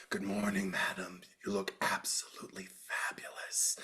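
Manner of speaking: breathy, hushed maid voice; meek servant whisper. Retold or imitated. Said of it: "she's just kinda like".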